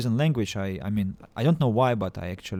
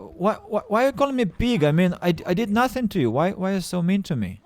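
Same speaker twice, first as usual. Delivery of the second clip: mock hurt whine; 6 semitones higher than usual. Protesting being called pig.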